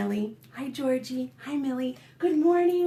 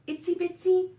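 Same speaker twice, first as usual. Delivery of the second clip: high little-spider voice; itsy bitsy voice; 5 semitones higher, 3 dB louder than usual. Shown as a demonstration. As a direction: say it tiny and high. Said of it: "itsy bitsy, the little ones".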